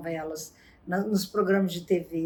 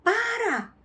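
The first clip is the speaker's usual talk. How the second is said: much higher, heated rebuff; angry boyfriend; 12 semitones higher than usual. Retold or imitated. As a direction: cut her off in a fight. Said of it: "E aí ele falava". (namorado irritado, voz bem mais aguda)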